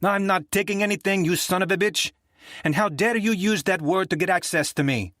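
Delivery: high, indignant fury; angry woman. Acted. Rita berating Nick on the phone, high and angry. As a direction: tear into him for using the code word. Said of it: "a woman's angry, rasping voice".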